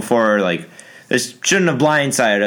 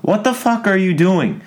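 higher-pitched, indignant challenge; real exasperation; 7 semitones higher than usual. Real, not acted. Vlogger scolding people who threaten the doctor, exasperated. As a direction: demand an answer, fed up. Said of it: "that makes me really mad".

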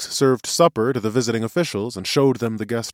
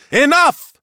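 very high, loud roar; 9 dB louder than usual. Acted. Seer silences the ranting accuser, roaring. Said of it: "roared the seer".

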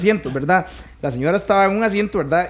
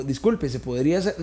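softer, lower, meek excuse-me; polite request, imitated; 6 semitones lower, 7 dB quieter than usual. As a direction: imitate someone asking courteously and quietly. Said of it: "Así se lo dijo". (imitando una petición educada, en voz baja)

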